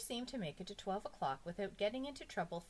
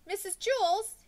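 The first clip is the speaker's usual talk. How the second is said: high, loud call for the teacher; raised, urgent call; 10 dB louder, slower than usual. A narrator's reading. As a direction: call out like a schoolboy in trouble. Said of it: "he called".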